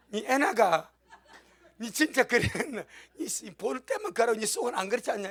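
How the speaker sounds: laughing, teasing Gyeongsang dialect. Acted. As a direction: Gyeongsang dialect, God gently calling out. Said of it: "경상도 말투로 말씀하십니다".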